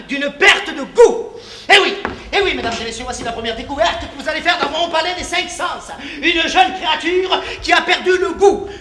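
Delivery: loud, high fairground barker; showman's pitch. Acted. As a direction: sell it to the crowd. Showman addresses the audience.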